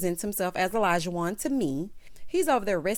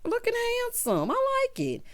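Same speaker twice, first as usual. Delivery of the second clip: mock admiring. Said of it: "I was like".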